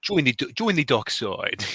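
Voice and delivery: slow, mock-menacing South African accent; a light South African accent on a villain's offer; South African Sith Lord. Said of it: "a South African Sith Lord".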